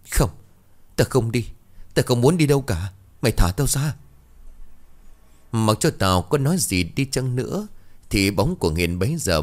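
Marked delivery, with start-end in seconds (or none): 0.1-4.0 higher, panicked protest; frightened refusal. Acted. Tao refuses to follow the ghost, higher and frightened. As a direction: refuse in rising terror.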